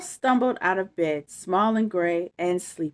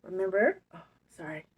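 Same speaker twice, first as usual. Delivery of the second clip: plain reading voice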